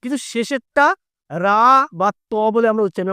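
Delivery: usual speaking voice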